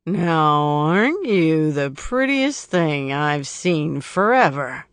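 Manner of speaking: put-on loud, slow slurred drunk leer; drunken slur. A narrator's reading. Drunk man leering at Lucy, slurring loudly. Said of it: "His words slur".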